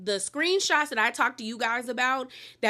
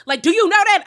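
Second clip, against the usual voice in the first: much higher and louder, a furious demand; mad outburst; 8 semitones higher, 11 dB louder, faster than usual. Retold or imitated. Heather confronting Gretchen, loud and furious. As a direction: fire the question at her, outraged. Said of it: "I knew Heather was mad".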